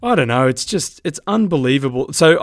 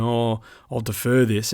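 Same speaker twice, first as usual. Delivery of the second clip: mock timid umpire; 2 semitones lower, 5 dB quieter than usual. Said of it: "you'll see an umpire going".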